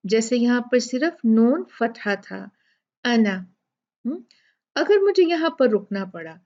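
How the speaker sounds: ordinary delivery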